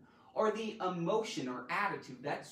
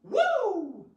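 loud, high "woo"; high-pitched holler; whoop; 13 semitones higher, 10 dB louder than usual. Real, not acted. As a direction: let out a big excited whoop. Whoops between parts of the lesson.

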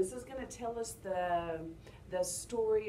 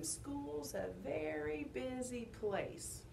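slow, softer demonstration; modelling the line; 5 semitones higher, 5 dB quieter, much slower than usual. Shown as a demonstration. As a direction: quietly model it, drawn out. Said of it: "you're gonna say".

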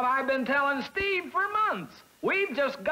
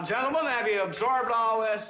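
ordinary talk, nothing marked

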